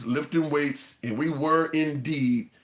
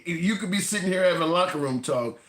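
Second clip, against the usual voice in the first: normal talking voice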